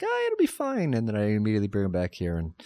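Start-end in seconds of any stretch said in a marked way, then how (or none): 0.0-0.9 much higher, breezy "oh it'll be fine"; mock carefree, high pitched. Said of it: "I was like".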